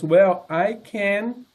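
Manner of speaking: ordinary delivery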